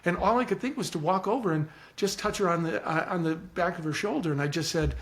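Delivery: ordinary talk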